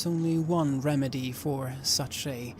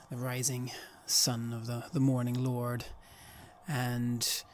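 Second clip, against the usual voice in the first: ordinary talk, nothing marked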